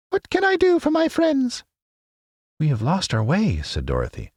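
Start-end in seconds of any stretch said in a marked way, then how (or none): 0.1-1.6 very high squeaky mouse queen; squeaky little voice. A narrator's reading. Queen of the Mice greeting her friends, squeaky little voice. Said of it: "asked in her squeaky little voice". 2.6-3.6 put-on girl's voice. A narrator's reading. Dorothy voiced.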